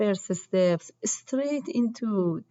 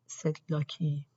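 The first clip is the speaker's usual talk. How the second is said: plain reading voice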